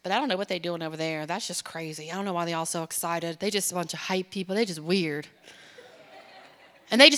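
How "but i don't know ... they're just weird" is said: low, fast scoffing bystander voice; mocking church sitter. Preacher mimics a judgmental churchgoer, low and fast. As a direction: sneer at the excited crowd from the sidelines. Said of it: "They're like".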